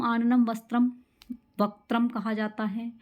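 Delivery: ordinary talk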